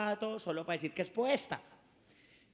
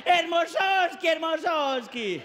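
much higher, loud, slow cheering; rapturous shouting crowd; 7 semitones higher, 6 dB louder, much slower than usual. Retold or imitated. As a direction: cheer wildly. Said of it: "Y todos".

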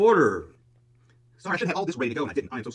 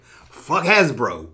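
drawn out, each word set apart; slow quoted title; much slower than usual. Retold or imitated. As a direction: quote your own video title slowly. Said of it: "That's why I make such videos like".

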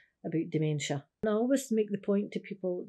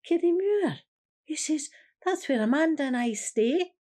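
high, bright, louder voice for her husband; a light imitation of her husband, higher and louder; husband's cheerful reply; 9 semitones higher, 6 dB louder than usual. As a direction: lift the pitch and announce it proudly. Confused husband naming his home.

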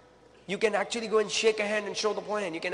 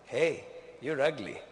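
slow, lower, cheeky parrot taunt; rude parrot; 5 semitones lower, slower than usual. Acted. Talking parrot insulting the lady outside the pet store, slow and smug. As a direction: drag out the insult like a smug bird. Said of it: "The parrot said".

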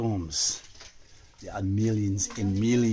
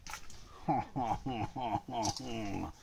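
soft laugh; 2 semitones higher, 6 dB quieter than usual. Pretend. Laughs at soup joke in character.

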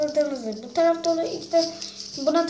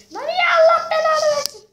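much higher, louder curse; furious high scream; 10 dB louder than usual. Real, not acted. Kid gamer curses the game after dying, furious high scream.

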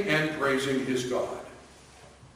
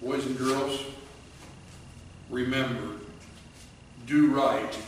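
softer, gentle closing words; tender blessing; 3 semitones lower, 8 dB quieter than usual. Real, not acted. Preacher to the boys and girls, tender and soft.

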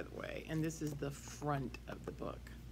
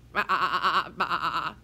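loud bleating baa-ah-ah; sheep bleat; 2 semitones higher, 11 dB louder, faster than usual. Acted. Teacher imitates sheep.

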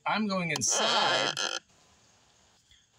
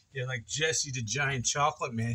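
ordinary talk, nothing marked

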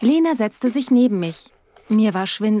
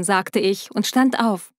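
plain reading voice

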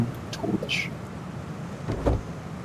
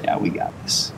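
ordinary delivery

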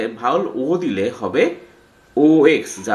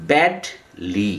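slow syllable demo; 5 semitones lower, much slower than usual. Shown as a demonstration. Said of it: "দেখো, badly".

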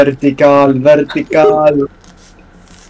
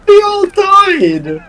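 mock lament. Higher dramatic repeat.

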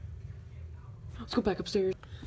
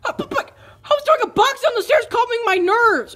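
upset cry; 9 semitones higher than usual. Acted. Upset at box thrown downstairs.

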